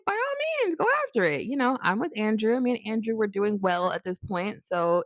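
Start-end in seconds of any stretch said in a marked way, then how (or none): 0.1-1.4 breezy go-ahead. Retold or imitated. Telling friend to date her crush.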